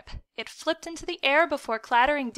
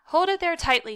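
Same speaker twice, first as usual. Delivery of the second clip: raised, firm command; instructing; 6 semitones higher, 5 dB louder than usual. A narrator's reading. Mother tending son's nosebleed, instructing firmly. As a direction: give the order higher and louder. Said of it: "she instructed".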